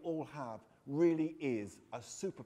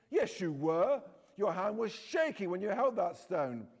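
louder, higher accusing jab; 4 semitones higher, 6 dB louder than usual. Acted. Narrator accusing Aaron of being afraid, louder retort. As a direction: push the accusation at him.

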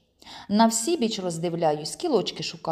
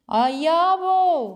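long high call across a field; shouting; 10 semitones higher, 6 dB louder, much slower than usual. A narrator's reading. Boy calling his friend lost in the corn, shouting. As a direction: call out loud, drawing out the vowels. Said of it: "гукаю нарешті".

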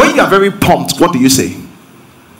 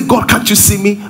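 higher-pitched, questioning plea; doubting question to God; 4 semitones higher than usual. Shown as a demonstration. Preacher shows words that bring fear, doubtful plea. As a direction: lift it into a mildly anxious question. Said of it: "One is going to bring you fear".

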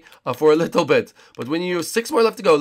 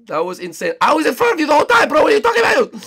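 much higher and louder, fast protest; insisting he was ahead the whole race; indignant yelling; 9 semitones higher, 9 dB louder, faster than usual. Real, not acted. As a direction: argue it hard, sure of yourself. Heated argument with rival.

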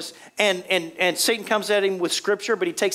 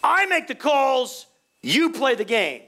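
imitated grumpy referee, high and slow; a mild retold yell, laying down who's boss; mock yell; 5 semitones higher, slower than usual. Preacher imitating a ref yelling at a fourth grader, mild mock yell. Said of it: "But the ref yelled at him".

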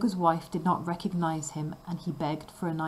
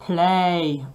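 roared; 7 dB louder than usual. A narrator's reading. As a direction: bark it like a giant. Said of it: "roared the ogre".

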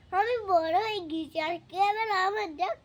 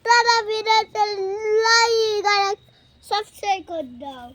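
loud call-out; 2 semitones higher, 10 dB louder than usual. Real, not acted. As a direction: belt it out to the audience. Child calls out like and subscribe.

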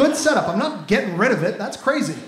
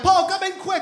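put-on high, urgent child voice; little brother calling; 11 semitones higher, 3 dB louder than usual. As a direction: imitate a small kid calling for help. Little brother calls from bathroom.

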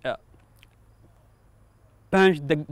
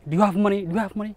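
higher-pitched, urgent retold demand; retold robber's demand; 5 semitones higher, 4 dB louder, much faster than usual. Driver retelling an armed robber's demand for cash, raised-pitch imitation. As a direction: imitate a robber pressing for money.

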